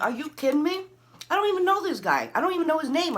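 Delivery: very high, loud protest; acting out frantic indignation; incredulous outrage. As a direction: protest in disbelief, voice shooting up. Acted outraged denial.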